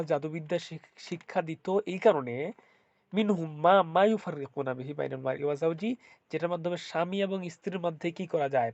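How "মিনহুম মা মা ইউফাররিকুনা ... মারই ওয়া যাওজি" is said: Quran recitation. Acted.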